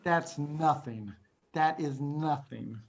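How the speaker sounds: slow, foreboding understatement; ominous teasing. Real, not acted. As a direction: draw it out quietly, hinting worse is coming. Teasing class about worse to come.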